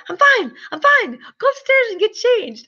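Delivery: high, hurried, ending in a whisper; flustered I'm fine. Retold or imitated. Wife on the floor hurrying her husband upstairs, high and flustered. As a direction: brush him off in a panic. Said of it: "I'm like".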